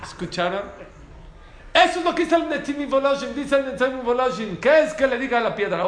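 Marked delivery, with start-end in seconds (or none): 1.7-5.8 loud, high-pitched preacher's declaiming; raised-voice sermon delivery; full-force preaching. Acted. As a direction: proclaim it to the whole room, voice raised.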